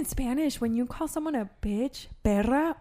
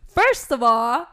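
mock confrontation; 6 semitones higher, 7 dB louder than usual. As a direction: dare a stranger to repeat it. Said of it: "I'd be like".

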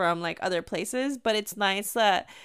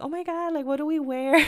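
high mock fretting; imitating fretting friend; 6 semitones higher than usual. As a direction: play a friend worrying, pitched up. Acted quote of second fretting friend.

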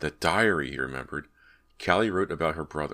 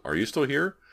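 high questioning call; searching call with a rising end; 10 semitones higher, 3 dB louder than usual. Acted. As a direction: call to check on someone unseen. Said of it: "Brant called".

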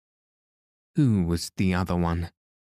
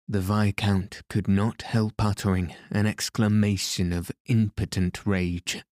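ordinary delivery

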